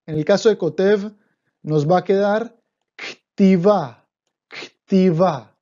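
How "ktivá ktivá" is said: ktivá: K straight into T, no vowel between; ktivá pronounced carefully. Shown as a demonstration. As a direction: say ktivá with the first letter silent, k-tivá. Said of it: "Ojo con esta pronunciación". (pronuncia ktivá con la primera letra muda, k-tivá)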